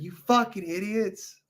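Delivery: mock-yell at careless caretakers; exasperated outburst. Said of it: "It's like".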